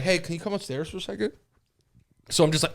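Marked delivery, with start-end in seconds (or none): 0.0-1.3 much softer, quick sweet mom call. Retold or imitated. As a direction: lure the kid up gently. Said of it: "she was like".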